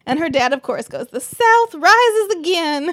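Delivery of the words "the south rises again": slow, loud, high rallying cry in imitation; mock triumphant cry. Her dad cheering the punch, mock-triumphant battle cry. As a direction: proclaim it grandly, stretching each word.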